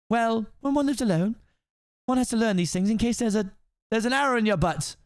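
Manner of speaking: prim princess. Acted. Princess explaining her skills, prim and high. Voices Fiona.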